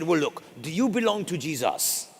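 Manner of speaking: put-on lightly French-accented question; French priest. French priest asks Jason, light French accent. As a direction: gently voice the French priest's point. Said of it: "he was visiting from France".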